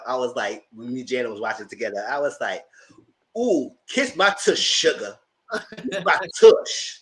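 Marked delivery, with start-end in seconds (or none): 3.4-7.0 slow, sassy drawn-out purr; movie line quote. Retold or imitated. Friend quotes a favorite Harlem Nights line with sass. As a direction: savor the line like a diva. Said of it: "I love that line".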